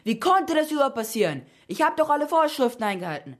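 high-pitched, agitated outburst; bellowing. A narrator's reading. Scipio outraged about his burnt buildings, bellowing. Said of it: "brüllte Scipio".

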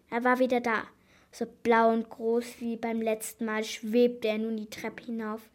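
ordinary delivery

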